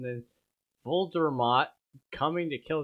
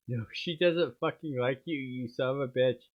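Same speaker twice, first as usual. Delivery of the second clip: ordinary delivery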